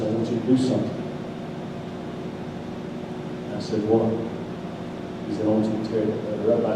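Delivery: low, soft and measured request; retold in a hushed, low voice; quiet instruction. Friend on phone asks preacher to act, quietly.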